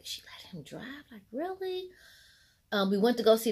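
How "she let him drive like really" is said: soft then a sharp high incredulous really; can't believe she let him drive; shocked disbelief. Retold or imitated. Friends in the car, shocked she let him drive, incredulous.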